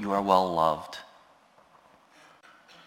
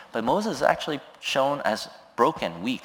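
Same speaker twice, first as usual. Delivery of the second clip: normal talking voice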